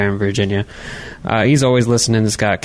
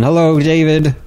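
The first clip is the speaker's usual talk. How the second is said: ordinary talk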